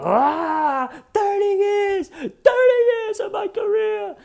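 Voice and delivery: mock screaming tantrum; mock blow-up. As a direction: explode in fake outrage. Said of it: "blow up".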